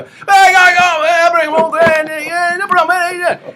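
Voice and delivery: very high, loud Aussie gibberish; unintelligible Australian producer. Retold or imitated. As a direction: imitate a garbled Australian on the phone. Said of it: "you did not understand what he was saying".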